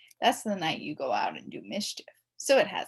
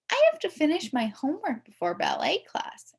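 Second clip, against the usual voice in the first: put-on prissy girl voice; 8 semitones higher, 3 dB louder than usual. A narrator's reading. Prissy classmate Andrea answers.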